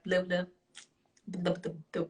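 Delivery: parent lightly mimicking a small son's babble; lightly imitated baby talk; imitating toddler babble. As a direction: run nonsense syllables like a little kid. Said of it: "That's how he talks".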